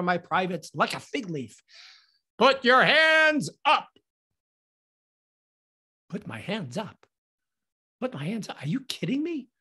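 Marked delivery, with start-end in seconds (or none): 2.4-3.9 loud, high, drawn-out command; cop's yelled order. Acted. Cop to the naked man with cupped hands, yelled order. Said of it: "he yells into the loudspeaker". 6.1-9.5 soft incredulous echo, rising at the end; quiet disbelief. Acted. Naked man to himself on the cop's order, quiet disbelief.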